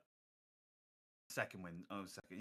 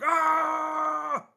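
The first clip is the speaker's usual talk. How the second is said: high, loud wild yell; battle shout; 7 dB louder than usual. Acted. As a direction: scream while spraying bullets.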